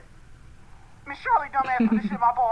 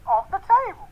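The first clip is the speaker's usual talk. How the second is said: ordinary delivery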